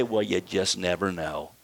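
a mumbled mock of a doubting believer. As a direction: sneer at lukewarm faith.

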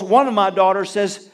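usual speaking voice

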